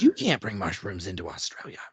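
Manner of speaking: low, hammed-up Aussie accent; terrible Australian accent. Airport officer refusing a traveller's mushrooms, bad Australian accent. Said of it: "my terrible Australian accent".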